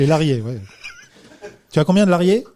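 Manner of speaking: "lariés" said for salariés, the "sa" dropped; missing syllable. Pretend. Host mocking the guest's trade talk, salariés clipped to "lariés". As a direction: leave off the first syllable, just "lariés". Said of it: "la syllabe qui manque".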